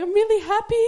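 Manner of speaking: high, loud comic wail dissolving into laughter; mock crying. New mother imitating herself over her baby, mock crying. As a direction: imitate yourself bawling with happiness. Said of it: "crying and crying and going".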